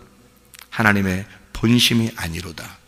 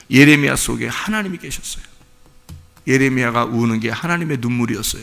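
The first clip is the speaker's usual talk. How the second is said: ordinary delivery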